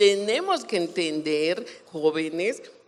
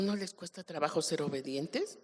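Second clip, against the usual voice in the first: usual speaking voice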